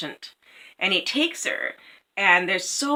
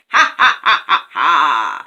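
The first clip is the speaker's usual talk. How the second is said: cruel raven laugh; 12 dB louder, slower than usual. A narrator's reading. Raven laughs at the prince's fate.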